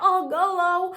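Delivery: a hammed-up lovesick sigh; mock sad sigh. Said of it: "yang kayak". (desahan galau yang dibuat-buat)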